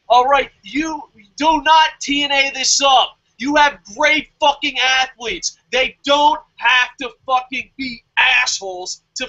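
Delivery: furious warning shout; angry shout. Real, not acted. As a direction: yell the order at them. Scolding New Japan.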